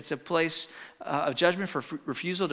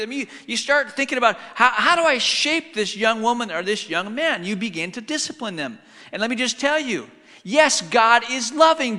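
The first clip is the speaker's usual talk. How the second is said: louder, higher pulpit preaching; full-force preaching; 6 semitones higher, 7 dB louder than usual. Acted. Pastor urging parents about discipline, preaching louder and higher. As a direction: preach it out to the back row.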